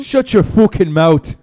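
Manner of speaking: hammed-up Irish brogue, telling someone off; Conor McGregor. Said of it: "do his fucking Irish accent".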